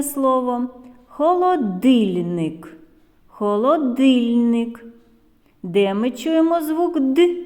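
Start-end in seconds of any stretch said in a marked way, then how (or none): 1.1-4.8 холодильник stretched slowly, hard д leaned on in the middle; slow, louder, careful холодильник; clearly sounded out. Shown as a demonstration. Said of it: "щоб добре було чути ці звуки". 7.0-7.4 Д as hard, unpalatalized "d"; firm Д with a short dark release; hard consonant. Shown as a demonstration. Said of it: "Твердий приголосний".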